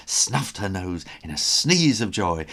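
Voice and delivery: ordinary delivery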